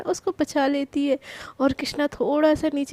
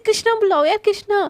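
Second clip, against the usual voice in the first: insistent correction; 5 semitones higher, 5 dB louder than usual. Acted. Krishna insists on her name.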